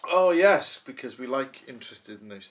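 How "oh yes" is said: sudden realisation. Real, not acted. Reacting to wife's reminder.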